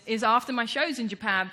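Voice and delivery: ordinary delivery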